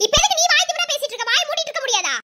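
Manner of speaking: irritated scolding; a touch of anger, snapping to silence them; angry. Acted. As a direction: tell them off crossly. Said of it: "எப்ப பார்த்தாலும் கோவப்பட்டுட்டே இருக்காது".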